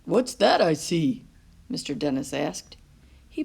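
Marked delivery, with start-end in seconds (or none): 0.0-1.2 louder, playful driver voice; playful bus driver voice; bus driver's trick. A narrator's reading. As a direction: play the magic trick for the boy. Driver Mister Dennis's trick.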